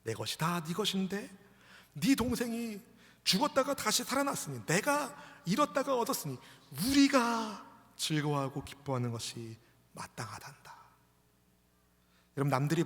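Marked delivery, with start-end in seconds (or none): 0.0-10.7 acted fatherly appeal, slowing at the close; pleading father. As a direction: reason with your son, rising then settling. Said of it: "그 아들에게 아버지가 말합니다".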